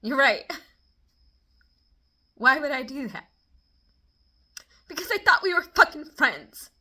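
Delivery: bitterly mock her agreement; loud sarcastic retort. Vlogger mocking friend's cold reply, sarcastic and loud. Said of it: "And immediately I was like".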